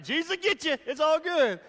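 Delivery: high, loud mock declaration; playful cross voice. Preacher joking about the lightsaber cross, high and loud.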